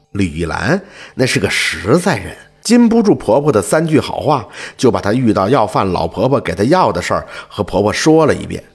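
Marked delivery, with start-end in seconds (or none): none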